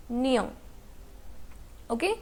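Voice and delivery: ordinary talk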